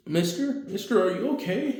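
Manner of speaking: put-on high, worried little kid; child voice. Child checks on Deku after his flashback, high worried child voice. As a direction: ask like a small worried child.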